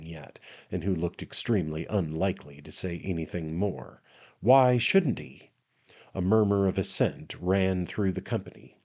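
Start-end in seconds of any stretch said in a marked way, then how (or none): 4.4-5.5 red-faced man agreeing. A narrator's reading.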